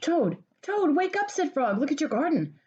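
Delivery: high, eager calling; excited wake-up call. Acted. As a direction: rouse a sleeping friend with good news. Frog wakes Toad excitedly.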